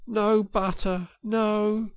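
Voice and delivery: high, slow woeful list; mournful Mole. A narrator's reading. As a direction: lament each missing thing. Mole lamenting.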